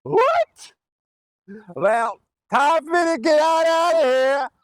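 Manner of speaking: high, hammed-up character voice; Mister Guest. Special guest needs to beat this person up, hammed-up high shouting. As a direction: call it out high with stretched phrase endings. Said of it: "I'm that special guest".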